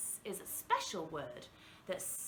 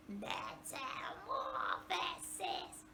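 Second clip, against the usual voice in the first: slow, softer zombie moan; 9 semitones higher, 7 dB quieter, much slower than usual. Shown as a demonstration. Teacher repeating the word like a zombie. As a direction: drag it out like the undead. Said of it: "like we are a zombie".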